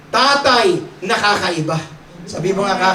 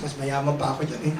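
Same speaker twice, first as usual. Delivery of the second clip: smug thought; 7 semitones lower, 10 dB quieter, faster than usual. Retold or imitated. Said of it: "iniisip ng Chinese".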